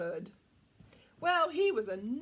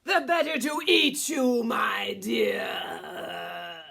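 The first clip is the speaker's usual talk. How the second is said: wolf booming the last line, louder; menacing roar; 2 semitones lower, 10 dB louder, much slower than usual. A narrator's reading. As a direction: let the threat explode at the end. Wolf's final answer.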